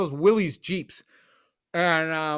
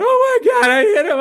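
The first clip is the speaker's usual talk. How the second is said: much higher and louder frantic cry; panicked driver; 9 dB louder than usual. Retold or imitated. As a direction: shriek it like a man who just hit a kid. Driver who hit a kid.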